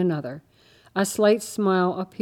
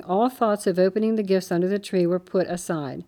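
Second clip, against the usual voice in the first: plain reading voice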